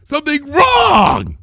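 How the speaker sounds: high, loud frantic yelling; high furious shout, slowing on the last words; outraged shout. Acted. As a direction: scream it in coffee-deprived fury. Twilight raging at Mrs Cake.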